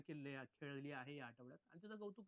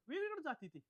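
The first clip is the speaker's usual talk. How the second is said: exasperated; 11 semitones higher, 6 dB louder than usual. Real, not acted. Exasperated complaint about a contestant.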